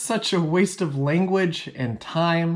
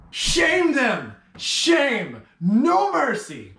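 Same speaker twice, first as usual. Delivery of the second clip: much higher rallying shout; rally the crowd, hammed-up; hammed-up call to shame them; mock battle cry; 8 semitones higher, much slower than usual.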